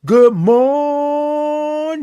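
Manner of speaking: high, full-voiced sung-out greeting; drawn-out morning greeting. Shown as a demonstration. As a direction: belt morning on one long high note. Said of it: "might not wanna say it like that".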